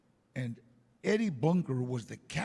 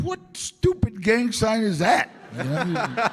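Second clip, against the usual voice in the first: loud laughing disbelief, slowed down; 8 semitones higher, 13 dB louder, slower than usual. Real, not acted. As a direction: laugh through the question. Laughing at a confusing hand sign.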